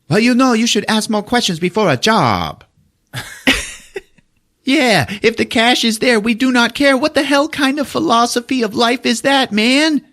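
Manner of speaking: high put-on voice for a movie line; mock movie character voice. Recapping a movie to friends, high mock voice.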